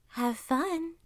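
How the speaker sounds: high, sly little send-off; grinning fox. A narrator's reading. Fox seeing off the hunter, grinning. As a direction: wish him well through a grin. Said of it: "grinned the fox".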